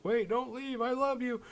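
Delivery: high, whiny mock plea; pleading Doug. Doug begging Brenda not to leave, high mock plea. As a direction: beg her to stay in a mock squeak. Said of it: "as he's like".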